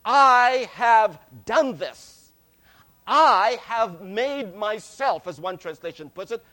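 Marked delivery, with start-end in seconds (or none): 0.0-5.3 I have done this, with I hit loud and slow; stress on I. Shown as a demonstration. Preacher showing the church's boast, loud stress on I. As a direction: lean hard on every I, slowly. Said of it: "the emphasis is upon the "I".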